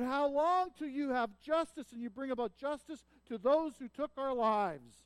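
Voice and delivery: high, loud martyrs' plea; crying out. Acted. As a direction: cry out for justice, the end slowing. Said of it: "they're crying out".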